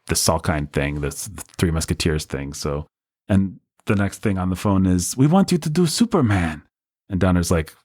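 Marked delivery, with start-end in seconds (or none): 5.2-6.6 imitated European producer. Producer's phone pitch, acted.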